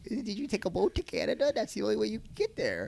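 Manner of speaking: high-pitched mock voice of a clueless man; acquaintance impression. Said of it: "this is how he talks".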